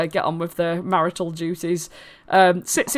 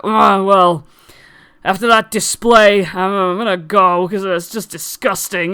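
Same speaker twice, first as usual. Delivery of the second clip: louder, scornful complaint; disgusted huff; 5 dB louder than usual. Acted. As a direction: storm off in disgust. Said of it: "he's like".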